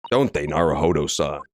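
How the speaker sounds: low, lightly voiced man disguise; a hint of a man's voice. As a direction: speak low as the disguised protagonist. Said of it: "so she gets the man voice".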